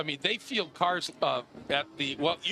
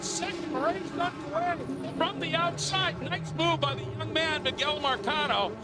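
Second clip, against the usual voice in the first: excited, higher race play-by-play; motorsport commentator; 10 semitones higher than usual. Real, not acted. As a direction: call the race live as it unfolds. Live race call.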